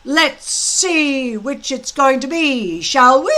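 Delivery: loud, high, gloating drill-master voice; a big blustering Viking instructor gloating at his recruits; booming Gobber. Acted. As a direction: lord it over the boys with relish. Gobber's speech to the boys.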